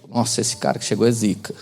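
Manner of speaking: low, soft, scornful "zica" with a dropping tone; mock contempt for a newcomer; disdainful zica. Shown as a demonstration. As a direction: say "é zica" like the guy is bad news. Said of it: "depende da entonação".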